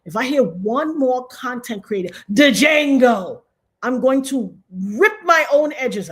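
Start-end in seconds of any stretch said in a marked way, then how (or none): 2.3-3.4 louder mock shout of "Django" with the D sounded; mocking mispronunciation. Shown as a demonstration. Complaining about content creators, shouted wrong "Django". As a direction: yell "Django" hard on the D, as the wrong way. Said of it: "Stop pronouncing the D".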